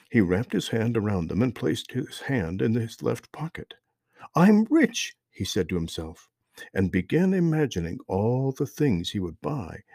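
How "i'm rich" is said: high, loud burst of joy; excited. Acted. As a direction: cry it out in delight. Discovers rubies, overjoyed.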